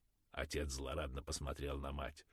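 ordinary delivery, nothing marked

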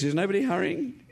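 normal talking voice

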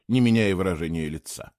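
ordinary delivery

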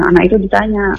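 ordinary talk, nothing marked

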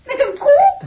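high startled cry; shocked cry. Acted. Iris sees a cow at the window, shocked cry. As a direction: gasp it out in disbelief.

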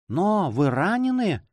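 high, drawn-out alarmed question; horrified cry. A narrator's reading. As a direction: gasp out the question in dread, without shouting. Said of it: "вдруг вскричала Донья Флора в ужасе". (испуганный возглас)

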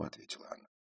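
usual speaking voice

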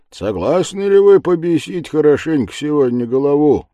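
loud, high, lively boasting; eager reveller. Acted. The reveller eggs the lads on, loud. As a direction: egg the lads on.

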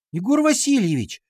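put-on high girlish whine; whining. A narrator's reading. Girl appealing to teacher, high whine. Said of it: "тут же заныла Дана".

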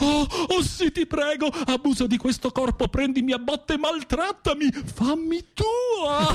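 camp mock seduction. Teasing his friend as a swooning diva in high falsetto. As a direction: ham up a swooning diva begging to be taken.

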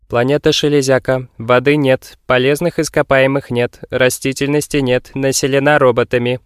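robot voice. Acted. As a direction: recite it like a cartoon robot. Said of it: "имитируя механический голос".

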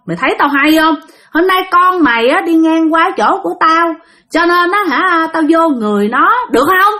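high, challenging spirit voice; possessing spirit. Retold or imitated. Spirit claiming the daughter before her mother, high taunting voice. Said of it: "là cô Út mới trả lời".

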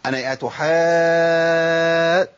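"أتحا" with the final "aa" held very long; slow, level, stretched "aa" in "أتحا"; obligatory long madd. Shown as a demonstration. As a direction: sustain the vowel of "أتحا" on one note. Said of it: "مد لازم".

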